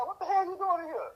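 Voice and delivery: high-pitched, puzzled mama voice; mock-puzzled, pitched well up; imitated mama's question. Mama to son sitting in the car, imitated high-pitched question.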